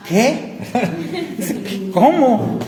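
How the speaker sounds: high, laughing what?; stunned disbelief. Retold or imitated. Friend hearing he's a pastor, high laughing disbelief. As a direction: gasp it out in laughing astonishment.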